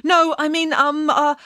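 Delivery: high, stammering correction; flustered backtracking. Acted. Puppet changes his lie to the fairy, flustered backtracking. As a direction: scramble for a new excuse. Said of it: "so he changed his story".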